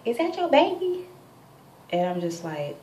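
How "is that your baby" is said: high, loud call across the fence; nosy yell over the fence. Retold or imitated. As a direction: shout it over to the neighbours' yard. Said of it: "like, yelled over the fence".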